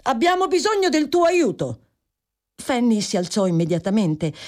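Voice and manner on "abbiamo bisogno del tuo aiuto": calling out. A narrator's reading. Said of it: "chiamò Tom Bertram".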